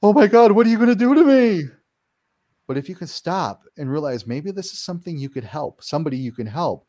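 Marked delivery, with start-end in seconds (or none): 0.0-1.6 much higher and louder, hammed-up panic; terrified. Victim of the paranormal pleading with the ghost: mock panic. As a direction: cry out like a scared victim. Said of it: "we're going".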